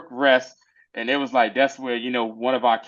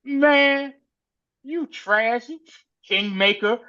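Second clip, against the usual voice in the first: scornful insult; 8 semitones higher, slower than usual. Retold or imitated. Lord insulting Kingmaker, high scornful put-down. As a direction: sneer it at him.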